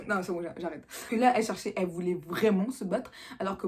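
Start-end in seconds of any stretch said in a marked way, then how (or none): none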